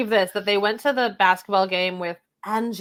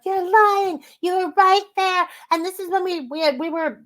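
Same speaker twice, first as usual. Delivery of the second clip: high indignant mock accusation; lightly hammed-up indignation; mock accusing Whitney; 9 semitones higher, 3 dB louder than usual.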